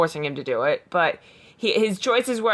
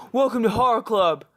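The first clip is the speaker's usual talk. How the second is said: higher, hearty gym bro greeting; 5 semitones higher than usual. Acted. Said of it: "you have to say in the bro voice".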